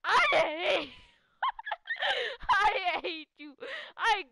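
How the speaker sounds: playfully furious at your friend; mock hate. Real, not acted. Player telling friend he hates him, laughing mock anger. Teasing friend after being ditched.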